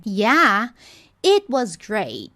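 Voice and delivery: drawn-out, very slow; slow motion demo. Shown as a demonstration. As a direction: stretch each sound out in slow motion. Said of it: "Olha o que que acontece quando eu coloco em slow motion". (em câmera lenta, bem devagar)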